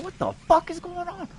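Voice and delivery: high-pitched retold bewilderment; bewildered disbelief. Telling how he reacted to the voices, high-pitched bewildered disbelief.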